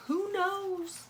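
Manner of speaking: Who knows in a high, lilting voice; high sing-song who knows. Real, not acted. Repeated in high voice.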